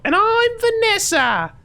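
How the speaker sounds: piercing high falsetto, drawn out; hammed-up falsetto sidekick intro; Vanessa. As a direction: squeal it as the host in a wig. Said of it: "but in a wig".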